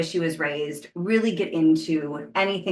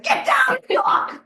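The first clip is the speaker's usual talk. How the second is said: frantic order; 4 dB louder, much slower than usual. Retold or imitated. Ordering New Yorkers to search.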